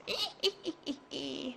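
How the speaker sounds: quiet cackling witch laugh; witch cackle. Acted. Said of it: "Can you do your witch's voice".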